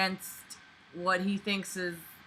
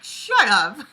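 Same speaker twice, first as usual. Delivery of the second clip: playful yell; 5 semitones higher, 9 dB louder than usual. Retold or imitated. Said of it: "I'm like".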